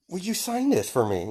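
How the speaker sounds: high, hopeful fanboy voice; eager fan. Acted. Izuku holding out his notebook to All Might, high and hopeful. Fan asks idol for autograph.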